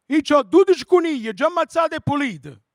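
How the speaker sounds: high, loud, shouted caller on a bad phone line; high loud shouting radio caller; old Italian caller. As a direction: yell into the phone like a nonna.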